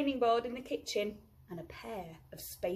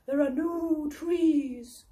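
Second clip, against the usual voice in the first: higher, slow owl; 6 semitones higher, 5 dB louder, slower than usual. Acted. Owl noting the bare moon in a slow, higher voice. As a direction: let the owl sound a little let down.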